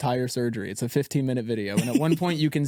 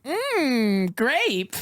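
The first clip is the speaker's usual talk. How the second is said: high, drawn-out mock savouring; pretend relish; 10 semitones higher than usual. Patient pretending to enjoy the medicine, mock relish. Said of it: "You should have been like".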